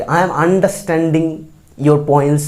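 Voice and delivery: I am understanding your points drawn out very slowly; slow, careful wrong sentence. Shown as a demonstration. Teacher shows the wrong form to avoid, slowly. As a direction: model the mistake slowly for the class. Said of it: "এটা কিন্তু বলি না".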